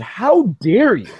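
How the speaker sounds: normal talking voice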